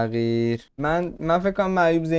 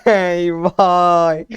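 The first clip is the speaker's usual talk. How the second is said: dragged-out scared moan; 3 semitones higher, 6 dB louder, much slower than usual. Real, not acted. Scared player in horror game.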